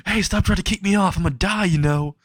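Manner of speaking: high-pitched mock panic; acted-out alarm, voice jumping up; alarmed protest. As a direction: protest as if about to fall, half in jest. Clinging boy protests being kicked off.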